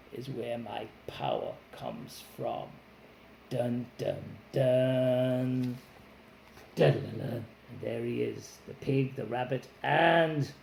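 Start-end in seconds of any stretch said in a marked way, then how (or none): 0.1-2.7 softer, lower secret; dragon whisper. Acted. Dragon ends secret softly. 3.5-7.4 dramatic dun dun dun. Pretend. Dramatic sound effect for class.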